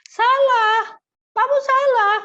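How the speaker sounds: "Salah" thrown out high and loud, then "kamu salah" in the same loud, high blaming tone; harsh scolding. Shown as a demonstration. Teacher contrasting a blaming tone with a plain one, harsh scolding. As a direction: scold the child for the mistake. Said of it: "Oh, itu kan lain".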